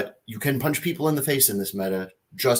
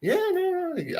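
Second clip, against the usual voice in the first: squeaky high no. Acted.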